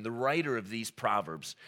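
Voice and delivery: ordinary delivery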